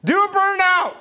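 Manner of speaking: put-on high, loud little-kid yell. Little niece yells at the passing Corvettes, high-pitched yelling. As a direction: shriek it like an excited niece. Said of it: "and she yells".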